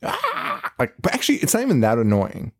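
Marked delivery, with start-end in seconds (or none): 0.0-0.7 very high, soft squeal; thin high squeal, pitched way up; mock alien scream. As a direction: imitate a shrill creature cry. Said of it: "the alien starts screaming".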